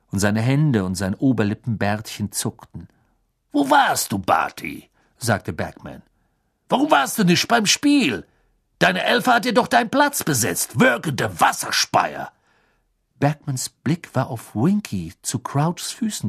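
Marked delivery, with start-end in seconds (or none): 3.5-12.3 pepper Barty with questions; bluff Bagman. Acted.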